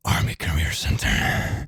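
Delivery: whispered sign. Acted. Voiced sign read.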